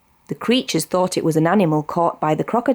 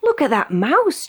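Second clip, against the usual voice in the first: chattering monkey; 8 semitones higher than usual. A narrator's reading. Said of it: "chattered a monkey".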